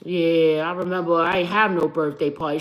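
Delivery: low, sulky man muttering; a lightly voiced sad sack; mopey Scorpio man. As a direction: play the gloomy guy in the corner. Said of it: "a Scorpio man in the corner like".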